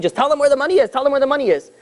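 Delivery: high-pitched pleading, no real scream; mock screaming. Wife in the corner begging her husband to tell, mock screaming. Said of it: "in the corner screaming".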